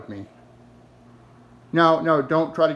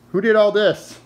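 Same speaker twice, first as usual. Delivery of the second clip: loud, higher accusing question; scolding; 7 semitones higher, 6 dB louder than usual. Real, not acted. Owner confronts Lois over the mess, loud scolding. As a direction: demand an answer from the guilty dog.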